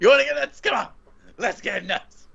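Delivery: high, manic movie-quote outburst; Batman. Acted. Podcaster imitating the actor's crazy scene, high manic outburst. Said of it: "That's why he's the best Batman".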